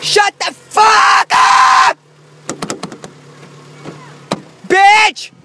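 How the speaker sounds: high, loud, slowly stretched shout; mock yell. Shouting back in mock anger at the echo. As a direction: hurl it out hammed-up angry.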